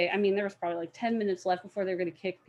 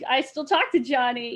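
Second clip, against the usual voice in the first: ordinary talk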